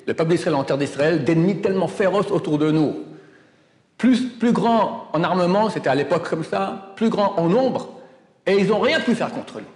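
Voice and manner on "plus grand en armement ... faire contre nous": ordinary talk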